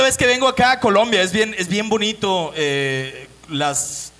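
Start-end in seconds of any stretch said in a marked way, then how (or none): none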